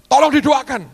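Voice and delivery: high, loud plea for prayer; urgent plea. Retold or imitated. Pastor mimics himself begging friends to pray, loud and high. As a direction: cry out for help from friends.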